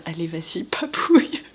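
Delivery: the pet asking for a back scratch in a whisper; voicing the pet. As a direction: play the dog begging for a back scratch. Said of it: "l'air de dire".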